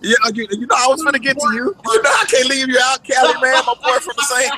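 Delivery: high, loud, laughing reassurance; excited protest. Real, not acted. Guest assures the host he can't be left out, excited and laughing. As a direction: protest loudly through the laughter.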